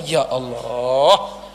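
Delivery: low, slow, drawn-out ya Allah; lamenting sigh. Real, not acted. Preacher sighs to the congregation, low and slow. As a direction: sigh it out as a lament over the people. Lament over Bani Israel.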